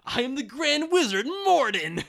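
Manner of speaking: booming villain wizard proclamation; megalomaniac rant. Acted. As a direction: declare yourself the evil wizard. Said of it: "sounds like a wizard".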